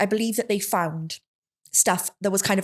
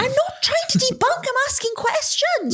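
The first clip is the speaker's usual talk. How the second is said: high-pitched, indignant self-defence; exasperated. Real, not acted. Friend defending their questions in argument, exasperated. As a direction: protest in a high, wound-up voice. Heated argument over a theory.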